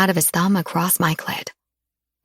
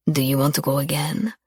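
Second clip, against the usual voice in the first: low, cocky tease; lover's cocky teasing question; 4 semitones lower than usual. A narrator's reading. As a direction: tease her with a smug edge. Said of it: "with a cocky, teasing edge to his voice".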